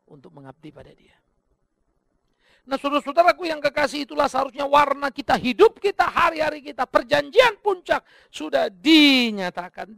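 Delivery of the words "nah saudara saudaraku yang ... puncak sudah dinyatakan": loud, high, ringing sermon voice; preaching at full force. Acted. Pastor urging the congregation, full-force preaching.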